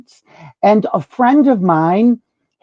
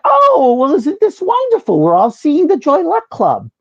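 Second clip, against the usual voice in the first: much higher, gushing Oh, well; delighted diva; 9 semitones higher than usual. Retold or imitated. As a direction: gush like a gracious star. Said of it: "So she said".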